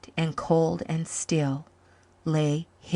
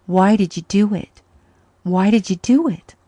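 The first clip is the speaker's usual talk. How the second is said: anguished question; 3 semitones higher, 8 dB louder than usual. Acted.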